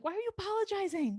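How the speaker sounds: high, fast disbelief; incredulous question. Retold or imitated. Parents answer the crying surrogate's apology, high and fast. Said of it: "We're like".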